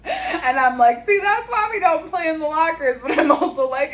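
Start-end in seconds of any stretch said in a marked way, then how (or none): 1.0-3.0 laughing scold. Retold or imitated. Scolding kids after injury.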